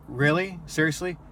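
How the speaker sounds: can't believe he's being asked this; incredulous. Retold or imitated. Driver to prying drunk passenger, incredulous. Driver reacts to second crude question.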